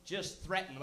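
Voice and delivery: usual speaking voice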